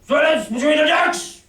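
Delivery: high, loud bellowed oath; villainous oath. Acted. Drunk pirate captain bellowing at the doctor for silence. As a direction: bellow it like a furious ship captain. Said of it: "broke out with a villainous low oath".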